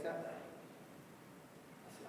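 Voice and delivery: usual speaking voice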